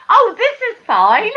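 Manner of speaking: breezy high 'oh, this is fine' through a laugh; laughing mock reassurance. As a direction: toss it off lightly while laughing. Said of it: "turned around to me and went".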